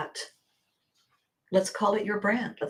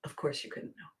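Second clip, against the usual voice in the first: soft unvoiced whisper, much softer; whispered repeat; 5 semitones lower, 15 dB quieter, faster than usual. Real, not acted. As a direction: breathe it out as a gentle whisper. Reassuring repeat of same phrase.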